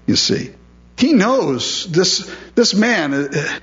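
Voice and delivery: ordinary talk, nothing marked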